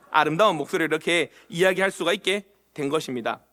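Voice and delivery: ordinary talk, nothing marked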